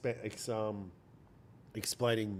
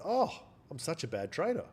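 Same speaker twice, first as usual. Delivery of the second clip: higher, louder mocking whine of your own brain; mock inner voice; 7 semitones higher, 4 dB louder than usual. Trader's brain taunting him at the market, higher mock whine. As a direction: lift it up like the brain's sing-song jab. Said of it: "your brain goes".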